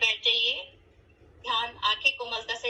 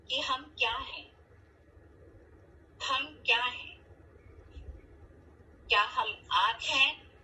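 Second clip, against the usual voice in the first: measured contemplative delivery with long pauses; guided meditation. Acted. Teacher guiding the class to reflect on who they are, meditative. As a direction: pose each thought, then leave silence to reflect.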